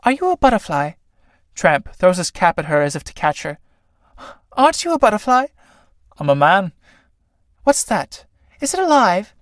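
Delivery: high flirty wonder. Acted. Clytie flirting with Tramp.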